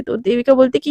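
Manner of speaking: plain reading voice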